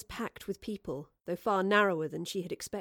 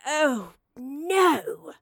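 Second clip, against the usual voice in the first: dismayed; 5 semitones higher, 7 dB louder than usual. A narrator's reading. Professor discovers map gone.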